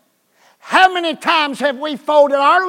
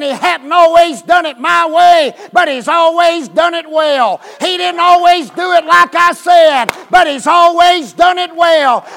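full-force preaching shout; 5 semitones higher, 6 dB louder than usual. Real, not acted. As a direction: shout it out to the church. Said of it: "I feel like shouting".

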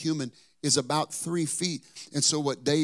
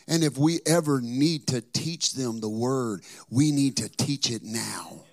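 slow, louder preaching that hammers each phrase; full-force preaching; 5 dB louder, slower than usual. Acted. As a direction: drive the message home slowly. Preaching to congregation.

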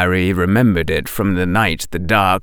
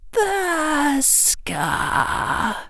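muttered; 4 dB quieter than usual. A narrator's reading. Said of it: "muttered Bellatrix".

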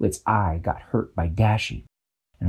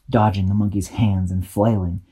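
plain reading voice

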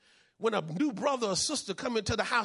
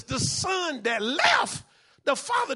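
drawn out, raised and slow; slow emphatic shout; 5 semitones higher, slower than usual. Real, not acted. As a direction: lean hard on each word. Preacher stresses point.

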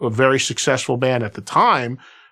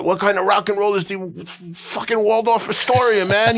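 rock star gripe; 5 semitones higher than usual. Retold or imitated. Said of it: "They're like".

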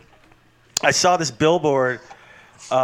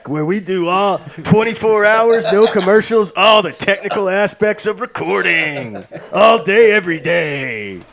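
loud, raised announcer hype; mock radio promo; 4 semitones higher, 4 dB louder than usual. Podcaster plugging all-day recording station, mock radio promo.

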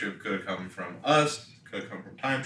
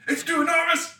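high, loud mock panic shout; hammed-up scientist; 7 dB louder than usual. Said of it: "he said".

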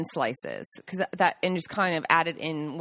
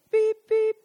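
high, loud beep-beep; much higher, punched toy-horn beeps; imitated car honk; 6 dB louder than usual. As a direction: mimic a little horn honking. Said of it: "familiar honk of Pierangelo".

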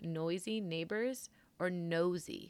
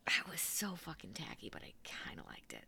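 soft breathy confession; whisper; 2 semitones lower, 11 dB quieter than usual. Real, not acted. To cohost after the cheesy outro, hushed whisper. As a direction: whisper it like a guilty secret.

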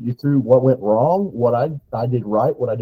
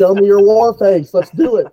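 higher, louder rallying call; host's pump-up cry to the hunters, louder; hype shout; 8 semitones higher, 8 dB louder than usual. Real, not acted. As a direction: fire up the crew. Hyping listeners up.

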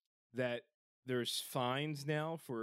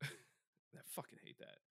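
whispered through a laugh, much softer; 17 dB quieter, slower than usual. Real, not acted. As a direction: breathe it out while laughing.

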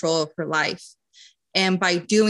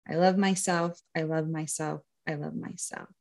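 guided affirmations; 4 dB quieter than usual. Acted. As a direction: lead them gently through affirmations. Leads an affirmation exercise.

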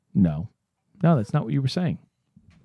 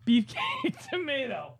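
much higher, laughing, slow British put-on; mock British seller; 12 semitones higher, slower than usual. Replaying the seller's tomatoes, laughing mock British. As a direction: ham the clerk through giggles. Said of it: "excuse me, sir".

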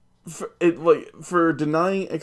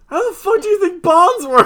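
incredulous Toby; 7 dB louder than usual. Retold or imitated. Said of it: "Toby's like".

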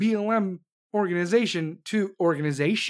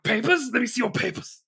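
higher-pitched mock German accent; 4 semitones higher than usual. Pizza place asking the family for papers, mock German accent. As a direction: imitate a German official checking papers. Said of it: "They did it with a German accent".

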